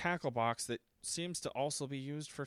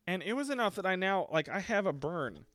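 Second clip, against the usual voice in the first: normal talking voice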